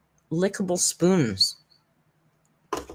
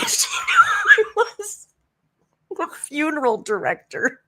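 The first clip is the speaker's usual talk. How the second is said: high laughing shout through the words; 13 semitones higher, 4 dB louder than usual. Real, not acted. Host telling viewers about the coincidence, laughing hard. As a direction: crack up while saying it.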